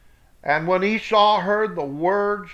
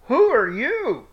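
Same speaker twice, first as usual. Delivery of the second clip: put-on higher, louder questioning old man; Isaac asking who stands before him, higher and louder; startled old father; 7 semitones higher, 4 dB louder than usual. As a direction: ask like a blind father caught off guard. Blind Isaac questioning his son.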